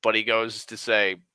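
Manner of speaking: ordinary delivery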